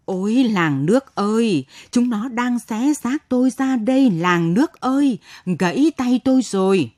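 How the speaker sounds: loud, high cry for help; acted old woman's yell to the villagers; desperate yell. A narrator's reading. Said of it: "lấy sức tàn hồn hển gào lên".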